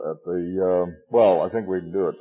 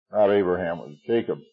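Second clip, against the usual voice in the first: usual speaking voice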